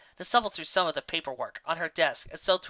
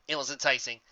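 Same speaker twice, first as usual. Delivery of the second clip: ordinary delivery, nothing marked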